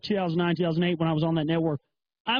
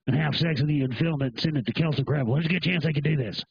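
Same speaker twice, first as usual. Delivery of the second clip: mock doom voice; 6 semitones lower than usual. Mock conspiracy rant, deep voice.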